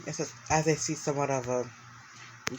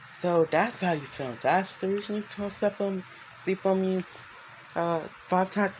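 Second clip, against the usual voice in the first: lightly voiced wolf, higher and teasing; a hint of a sulky wolf companion; higher Fluff voice; 6 semitones higher than usual. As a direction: play Fluff a touch higher, needling.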